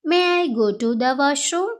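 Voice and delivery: very slow, clear classroom English; slow model phrase. Shown as a demonstration. Teacher modelling the phrase for Telugu students, slow and clear. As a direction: spell it out slowly for learners. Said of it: "అప్పుడు ఇలా అనాలి".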